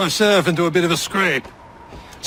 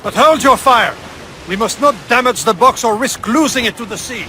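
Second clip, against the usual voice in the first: much louder, pressing warning; urgent shout; 3 semitones higher, 6 dB louder than usual. Acted. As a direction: yell it over cannon fire. Ally yelling warning mid-battle.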